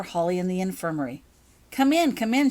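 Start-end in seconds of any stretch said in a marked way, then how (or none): 1.7-2.5 bright, higher welcome; encouraging. A narrator's reading. Nurse welcoming Holly into the infirmary, encouraging. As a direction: coax her in warmly, lifted and louder. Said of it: "she encouraged".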